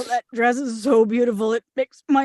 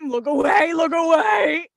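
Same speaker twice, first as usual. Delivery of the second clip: embarrassed shout; 3 semitones higher than usual. Acted. Sitting in the sink.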